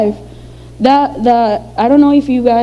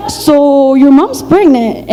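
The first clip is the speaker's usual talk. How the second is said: shocked stepmom; 3 semitones higher, much slower than usual. Retold or imitated. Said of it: "She was, like, shocked".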